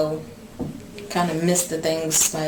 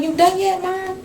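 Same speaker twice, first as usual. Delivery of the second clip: put-on much higher, impatient little girl; imitating her daughter; 14 semitones higher, 4 dB louder than usual. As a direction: whine it like an impatient child. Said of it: "She be like".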